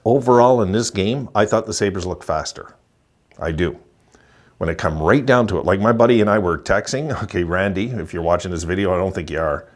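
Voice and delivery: normal talking voice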